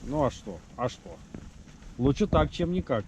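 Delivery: usual speaking voice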